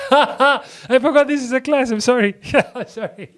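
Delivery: high, giggly sheepish apology; laughing apology. Real, not acted. Teacher remembering this is a class, laughing sheepishly. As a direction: apologise through real laughter.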